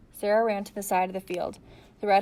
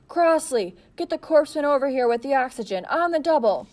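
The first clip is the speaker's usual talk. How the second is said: higher, louder commanding bellow; 6 semitones higher, 7 dB louder than usual. A narrator's reading. Marine officer bellowing to a soldier across the field, commanding. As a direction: bark an order across the field. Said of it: "bellowed at someone".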